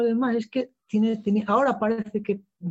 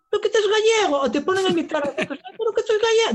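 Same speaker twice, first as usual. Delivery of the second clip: much higher put-on voice, protesting; mock incredulous squeal; 14 semitones higher than usual. Asturian actor retelling being taken for Galician, high mock-incredulous voice.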